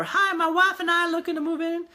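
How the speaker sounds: mock cheerful high voice of a home-seeking husband; changed voice. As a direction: pop into a high, sing-song fake persona. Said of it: "I don't know why I changed my voice".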